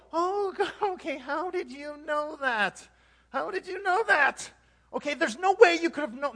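high, loud stunned disbelief; hammed-up astonished outburst; mock amazement. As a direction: cry out as if your secret was just revealed. Acts amazed receiver of prophecy.

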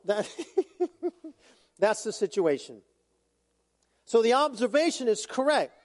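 ordinary talk

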